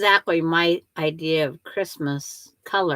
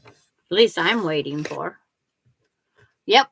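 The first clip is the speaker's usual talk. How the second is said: ordinary talk, nothing marked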